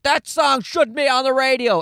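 high mock-indignant complaint; imitating a social media scold, lightly yelling; mock outraged poster. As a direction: whine it like an online busybody. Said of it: "yelling about".

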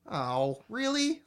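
mock-incredulous rising question; retold disbelief. Host reacting to a pointless album intro, incredulous. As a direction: pitch jumps up on "really". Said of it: "I go".

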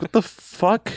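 high, disbelieving outburst; incredulous. Retold or imitated. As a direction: blurt it out in disbelief. Disgusted reaction to makeup.

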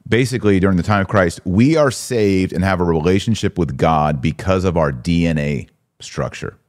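ordinary talk

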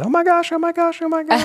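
very high, squeaky parrot voice; excited parrot voice. Retold or imitated. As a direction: squeal it like a thrilled bird. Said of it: "she got really excited and said".

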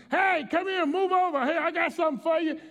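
high-pitched street-corner holler; calling out. Retold or imitated. Woman calling to passersby on the corner, imitated holler. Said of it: "she was calling people".

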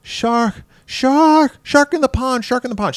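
put-on high-pitched girl's cry of alarm; very high, half-volume shriek; mock scream. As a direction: imitate a panicked girl screaming a warning. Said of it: "and she screams".

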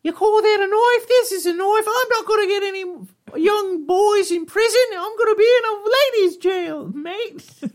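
high, loud put-on Australian accent; exaggerated high Australian impression. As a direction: ham up an Aussie bloke, high-pitched.

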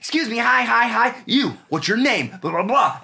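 shouting paparazzi. Retold or imitated. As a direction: yell for attention over the crowd, laughing. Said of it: "There's photographers yelling at you".

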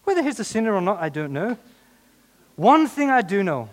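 loud, high Scottish lilt; accidental Scottish accent. Acted. Preacher reads healed man's answer, drifting into Scottish accent. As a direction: slip into a Scottish brogue. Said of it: "I'm getting Scottish".

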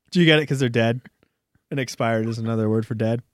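usual speaking voice

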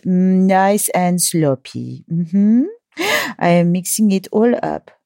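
French chef savouring a revolting recipe; French accent. Pretend. French chef mixes a disgusting soup for listeners, playful French accent. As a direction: mutter over the ingredients in a hammed-up French accent. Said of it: "to keep up my French accent".

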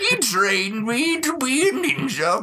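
high, slow raspy prospector; old prospector Batman. Retold or imitated. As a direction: spin a yarn like an old miner. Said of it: "old prospector Batman is awesome".

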